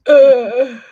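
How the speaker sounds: loud mock-wounded "uh"; wounded groan. Guest reacts to the receptionist's pencil jab, wounded groan.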